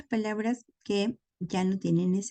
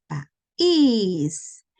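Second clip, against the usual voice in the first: país with a loud, drawn-out accented í; stressed final syllable; 5 dB louder than usual. Shown as a demonstration. Teacher showing a broken diphthong, stressed í in país. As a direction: lean hard and long on the í in país. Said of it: "pero tomó fuerza con este".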